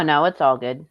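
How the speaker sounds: ordinary delivery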